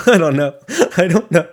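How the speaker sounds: laughing out loud through the words. Real, not acted. To listeners after saying your dog will die, real laughter. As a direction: let the laugh burst through. Laughs at his own bleak line.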